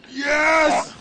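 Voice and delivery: high, very loud cheer; excited shout. Real, not acted. Player urged on in game, excited shout. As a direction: yell it out in triumph.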